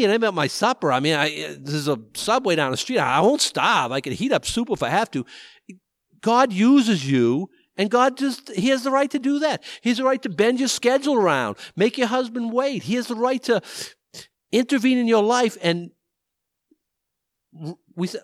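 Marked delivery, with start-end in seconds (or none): none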